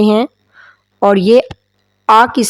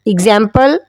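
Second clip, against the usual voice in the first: ordinary delivery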